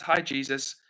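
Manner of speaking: normal talking voice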